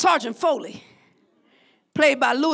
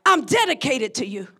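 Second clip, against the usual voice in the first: normal talking voice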